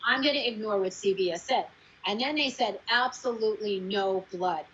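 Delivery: ordinary talk, nothing marked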